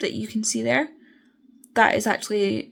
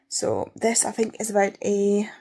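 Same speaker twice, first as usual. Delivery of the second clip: normal talking voice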